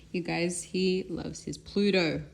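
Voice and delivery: ordinary talk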